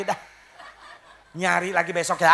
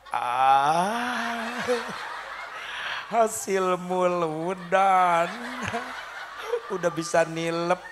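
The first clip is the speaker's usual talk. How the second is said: mocking husband; much slower than usual. Husband mocking wife's sermon lesson.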